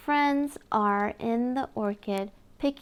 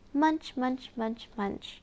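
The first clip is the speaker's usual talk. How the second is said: playful chewing noises, fading away; munching sounds; 5 dB quieter than usual. Acted. Showing a toddler how the bunny eats, playful munching. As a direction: chomp it like a hungry bunny.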